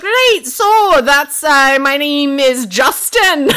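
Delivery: put-on slow, loud Scottish accent, high. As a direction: drag it out in broad Scottish. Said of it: "Full Scottish accent".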